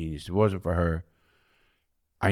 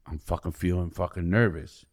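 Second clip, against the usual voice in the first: normal talking voice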